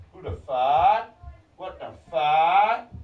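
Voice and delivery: slow holler with stretched words; drawn-out mock chant at full volume; loud mocking echo. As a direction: drag each word out loudly, questions swooping up.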